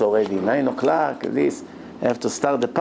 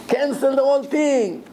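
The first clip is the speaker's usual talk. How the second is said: much higher, forceful "cancel"; furious order; 9 semitones higher than usual. Retold or imitated. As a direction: slam down the decision. Grandfather repeats order.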